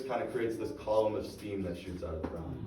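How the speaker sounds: ordinary talk, nothing marked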